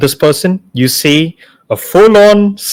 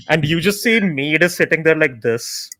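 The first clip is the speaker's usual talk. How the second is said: normal talking voice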